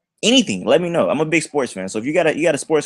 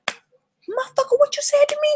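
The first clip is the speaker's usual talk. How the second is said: mock outraged girl. Girl confronting him over the poem, mock outraged. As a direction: imitate an offended girl in falsetto.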